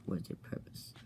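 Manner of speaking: the wizard asks in a low whisper; whispered question. Acted. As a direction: hush it like a secret demand. Epicard questions the visitors.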